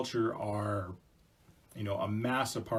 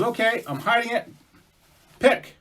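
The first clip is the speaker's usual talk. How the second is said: beaver voice; 11 semitones higher, 7 dB louder than usual. Acted. Beaver challenges.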